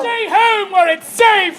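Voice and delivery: high pleading yell, slowed; crowd scream. Acted. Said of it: "people of Rome scream".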